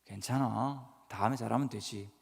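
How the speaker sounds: quiet, tender reassurance; soft reassuring. Shown as a demonstration. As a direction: gently comfort your child after a bad report card. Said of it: "그런 거 묻지 마시고". (성적표를 가져온 아이를 다정하게 달래는 말투)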